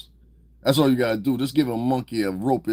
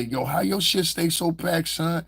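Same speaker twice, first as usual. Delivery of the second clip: higher, drawn-out mock questioning; imitating fellow inmates; 8 semitones higher, slower than usual. Inmate questioning another about his snacks, mock-curious. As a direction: put on a higher voice for a nosy inmate.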